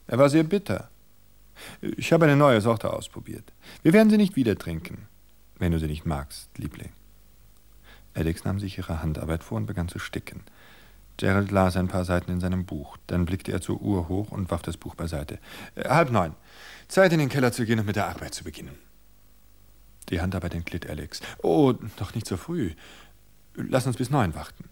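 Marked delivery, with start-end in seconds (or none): 0.1-6.9 high, loud, eager-to-please wife voice; sweetly overeager, higher and louder; placating wife. Acted. Wife soothing her husband about the bitter coffee, high and eager. 15.8-18.8 brisk husband. Acted. Husband announcing the cellar work. 21.4-24.6 pleading wife. Acted.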